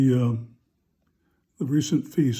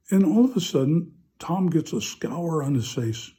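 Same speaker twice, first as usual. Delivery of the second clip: ordinary talk, nothing marked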